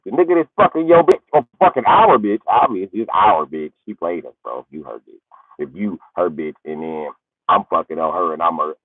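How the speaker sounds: usual speaking voice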